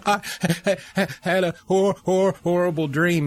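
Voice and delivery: put-on high, broken voice retelling a sobbing boy; voicing a frightened son's sobbing words, pitched high; imitating sobbing child. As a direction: stammer through it like a crying son. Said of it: "sobbing, crying".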